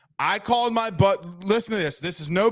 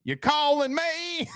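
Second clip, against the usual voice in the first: put-on slow, higher kid twang broken by a laugh; kid's twang; 9 semitones higher, slower than usual. Dad mimics his kid's twang, slow and laughing. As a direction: drawl it out like a little kid, laughing. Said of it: "kids get their little twang".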